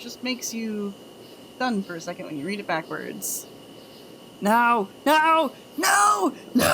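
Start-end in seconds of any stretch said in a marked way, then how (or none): 4.4-6.6 loud no's climbing higher each time; escalating outcry. Real, not acted. Streamer recoiling from a viewer's terrible joke, escalating outcry. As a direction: shout it louder and higher, breaking into laughter.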